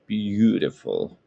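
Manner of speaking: lightly imitated US president, words drawn out; half-successful presidential impression, stretched words; forty-fifth US president. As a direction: have a go at a slow presidential "beautiful". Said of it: "How the forty-fifth president of the United States of America would say it".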